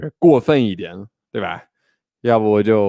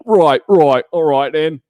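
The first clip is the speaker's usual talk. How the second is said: higher, louder mock Australian accent; cheap Australian accent; 5 semitones higher, 5 dB louder than usual. Shown as a demonstration. Host repeats his cheap stand-in accent, exaggerated Australian. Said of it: "a really cheap, like".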